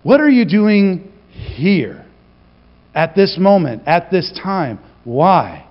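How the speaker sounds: very slow, weighing each phrase; probing, deliberate questioning. Shown as a demonstration. God questioning Jonah's moment, very slow. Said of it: "how about this one".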